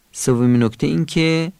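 usual speaking voice

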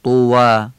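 توا loud, with a rounded lip w; Arabic waw. Shown as a demonstration. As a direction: say توا with heavy ط and Arabic w, never v. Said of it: "واوم که باید عربی بگیم".

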